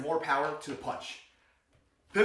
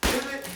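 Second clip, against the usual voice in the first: pivot popped out high; a high-pitched punch on pivot; emphasis on pivot; 11 semitones higher, 4 dB louder than usual. Shown as a demonstration. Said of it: "emphasis on the pivot".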